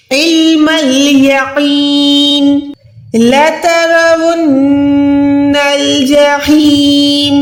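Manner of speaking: slow recitation with heavy qaf in الیقین; slow Quranic recitation; tajweed recitation. Shown as a demonstration. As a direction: chant slowly, qaf full. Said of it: "قوف کو موٹا ادا کرنا ہے".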